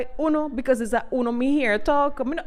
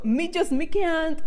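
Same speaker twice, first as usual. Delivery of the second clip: rising passion; 8 semitones higher than usual. Real, not acted. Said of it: "me can't hold my tongue".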